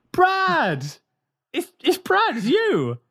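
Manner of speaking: high-pitched starstruck gush; starstruck squeal. Retold or imitated. A fan meeting Brad in the nightclub, high squealing gush. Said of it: "He'd be like".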